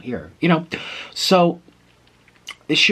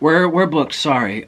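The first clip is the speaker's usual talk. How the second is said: mildly brusque retold clerk, louder; brusque refusal; 4 semitones higher, 6 dB louder, slower than usual. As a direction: imitate a clerk turning someone away. Desk clerk turning traveler away.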